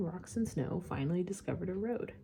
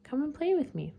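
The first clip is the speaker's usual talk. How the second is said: little prince voice; 8 semitones higher, 5 dB louder than usual. A narrator's reading.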